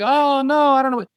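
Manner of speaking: high, mock-panicked doctor voice. Doctor freaking out in front of a patient, mock panic. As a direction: act out a doctor losing it in alarm. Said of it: "freaking out and crying".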